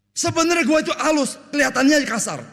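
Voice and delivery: high-pitched shouted self-defence; hammed-up brash yelling; mock rough talk. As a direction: act a coarse person loudly insisting. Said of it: "Gila, dia kasar sekali".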